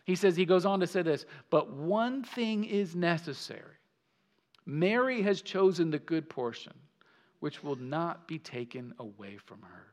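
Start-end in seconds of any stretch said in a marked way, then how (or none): 1.5-9.8 soft, low gentle rebuke; compassionate tender. Retold or imitated. Jesus gently calling out to anxious Martha, softly tender. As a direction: speak her name with tenderness. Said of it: "in a compassionate, tender tone".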